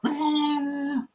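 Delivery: high, drawn-out mock wail; riled-up outburst. Coworker riled up at being asked to keep it down, high mock outburst. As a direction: imitate her blowing up with a long high cry. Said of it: "She riled up".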